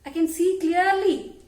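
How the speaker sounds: a hint of a proud owl calling out; boasting owl. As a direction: raise your voice as a boasting owl. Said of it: "So owl is saying".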